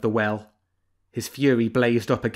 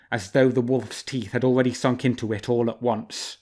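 ordinary delivery

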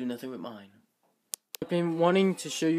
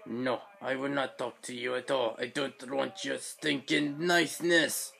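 stubborn robot in a put-on accent; slower than usual. Toy robot rejecting its owner's kindness, huffy character voice. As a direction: refuse huffily, drawing out the last words. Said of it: "B B eight's still not talking to me".

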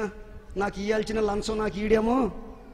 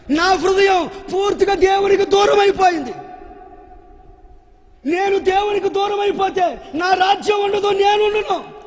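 tearful king's cry; 7 semitones higher, 5 dB louder than usual. Retold or imitated. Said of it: "అని కళ్ళ నిండా కన్నీళ్లు పెడుతూ".

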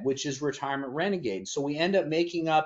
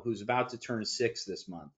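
normal talking voice

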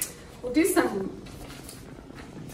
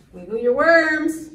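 ordinary delivery, nothing marked

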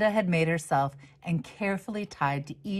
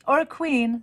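a much higher, louder voice for a neighbour's playful quip; Miss Turner's quip; 9 semitones higher, 5 dB louder than usual. Acted. A neighbour adding to the king remark, higher and louder. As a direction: pop the quip up high and bright. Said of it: "said Miss Turner".

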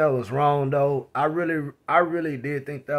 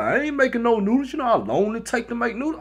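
dismissive; 7 semitones higher, faster than usual. Retold or imitated. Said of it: "he was like".

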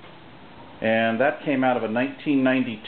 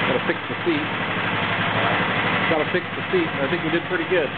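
higher, raised pitch over the motor; talking over engine noise; 6 semitones higher than usual. Real, not acted.

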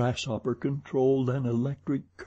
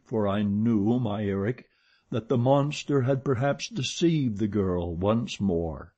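plain reading voice

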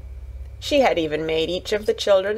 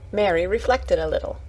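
usual speaking voice

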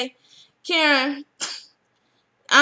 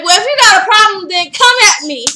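higher, louder, fast taunt; mock loud challenge; 6 semitones higher, 9 dB louder than usual. Natalie dares Renee to come at her, a loud fast taunt. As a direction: throw down the challenge. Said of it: "Natalie's gonna say".

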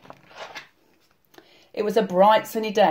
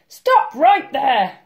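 slow, loud, high command; commanding granny shout; yelled; 7 semitones higher, 6 dB louder, much slower than usual. A narrator's reading. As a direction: yell a halt as Granny. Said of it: "yelled Granny".

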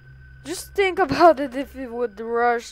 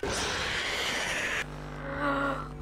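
frightened gasp; 2 semitones higher, 7 dB quieter than usual. Real, not acted. Caught by animatronic.

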